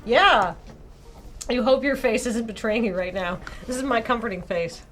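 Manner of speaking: ordinary delivery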